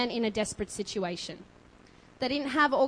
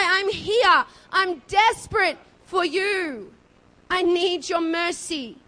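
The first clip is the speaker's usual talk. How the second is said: raised, urgent shouting that builds to full cries; desperate yelling; 6 semitones higher, 8 dB louder than usual. Acted. Beggar defying the crowd to reach Jesus, desperate shout. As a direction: yell all the more, stretching the final pleas. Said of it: "Bartimaeus all the more yells out".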